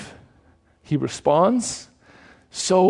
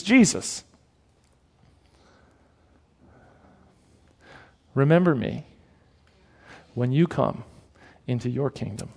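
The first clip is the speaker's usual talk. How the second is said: soft plea; 5 semitones lower, 5 dB quieter than usual. Retold or imitated. Preacher voicing the dying thief pleading with Jesus, softly. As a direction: softly beg to be remembered.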